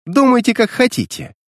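high-pitched defiant exclamation; exclaiming. A narrator's reading. Diana answers the detective's scorn with a high exclamation. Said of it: "воскликнула она".

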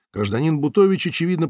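plain reading voice

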